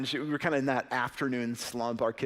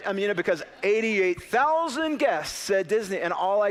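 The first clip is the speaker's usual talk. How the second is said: worked-up retelling; 6 semitones higher, 5 dB louder than usual. Real, not acted. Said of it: "recalls panic, loud and high".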